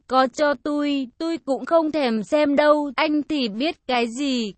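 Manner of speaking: ordinary delivery, nothing marked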